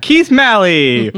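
slow, drawn-out guest introduction; host presenting the next guest; host intro. Acted. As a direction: announce him like a talk show host.

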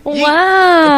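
real gasp of surprise; surprised gasp. Real, not acted. Hearing Keith won the hunt, a surprised gasp.

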